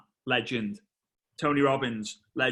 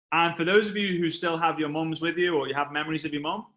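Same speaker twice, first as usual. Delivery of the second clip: ordinary delivery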